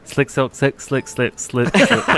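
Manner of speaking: quick, tumbling repeats of "slick". Shown as a demonstration. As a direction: rush "slick silk" until it trips. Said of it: "Say it faster".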